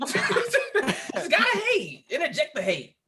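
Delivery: laughing mock voice. Mocking the interrupting cohost.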